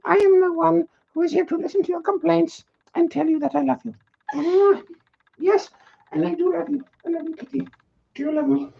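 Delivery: high, giggly voice for the cat as HR rep; a high, playful made-up cat persona; cat's HR voice. Pretend. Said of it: "Panther from H R".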